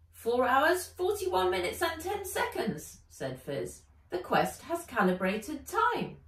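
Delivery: light, chirpy creature voice; small dragon. Acted.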